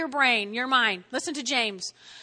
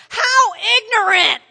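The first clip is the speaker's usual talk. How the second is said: scornful shout, high and loud; hammed-up sarcastic outburst; Paul; 8 semitones higher, 11 dB louder than usual. Preacher voicing Paul rebuking the comparers, loud sarcastic scorn. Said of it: "he gets a little sarcastic here".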